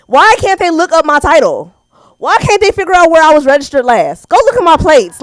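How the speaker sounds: high, loud comic complaint; exasperated rant. Acted. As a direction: fire indignant questions at a useless office. Exasperated rant about the DMV.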